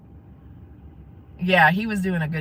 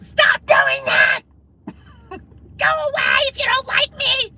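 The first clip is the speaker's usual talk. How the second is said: yelling at the dog; slower than usual. Retold or imitated. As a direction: yell at the dog to go away, half laughing. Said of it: "I yelled at him".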